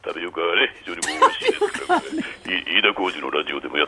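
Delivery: a deep, earnest commentator voice, pressing ahead quickly at the end; a sober pundit plugging tapioca in a low voice; Suda Shinichiro impression. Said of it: "須田ちゃん".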